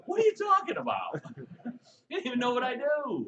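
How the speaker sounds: high, loud mock disbelief. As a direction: play your own baffled reply. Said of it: "I'm looking at him going".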